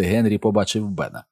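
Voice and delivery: plain reading voice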